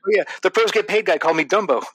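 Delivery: mock caller voice. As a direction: squeak it out as a gushing fan. Mocking the caller's reaction.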